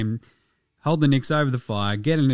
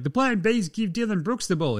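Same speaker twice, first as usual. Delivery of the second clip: high, mocking put-on voice; mock team voice; 8 semitones higher than usual. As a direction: sneer out the dumb plan in a silly voice.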